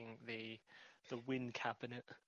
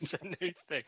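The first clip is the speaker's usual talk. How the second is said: laughing echo; 5 semitones higher, 3 dB louder, faster than usual. Retold or imitated. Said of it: "co-host echoes, laughing".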